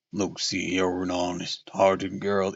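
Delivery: deep manly voice for the gentleman suitor. Acted. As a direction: drop low for the earnest cowboy suitor.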